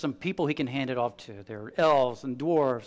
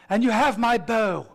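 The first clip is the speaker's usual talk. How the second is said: higher, lighter elf voice; Legolas the elf; 7 semitones higher, 4 dB louder than usual. Retold or imitated. Legolas pledging his bow to Frodo, higher. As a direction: lift it up like a graceful elf. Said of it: "Legolas the elf says".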